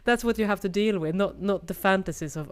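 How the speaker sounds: ordinary talk, nothing marked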